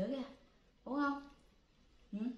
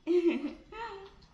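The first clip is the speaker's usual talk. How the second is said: high laugh; 6 semitones higher, 4 dB louder than usual. Real, not acted. Laughs while with the children.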